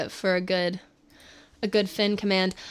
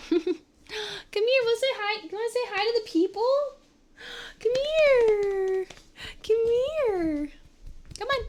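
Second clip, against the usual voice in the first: overwhelmed by cuteness; 10 semitones higher than usual. Real, not acted. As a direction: coo at a puppy, melting. Cooing to her dog.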